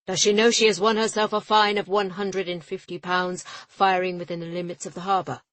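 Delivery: a furious port official bellowing; indignant official. A narrator's reading. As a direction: hurl the fine at them.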